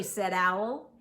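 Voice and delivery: plain reading voice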